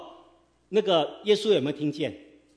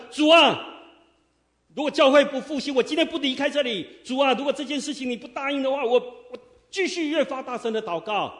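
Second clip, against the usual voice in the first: loud fervent prayer; 8 semitones higher, 4 dB louder than usual. Shown as a demonstration. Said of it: "大声的，越发大声".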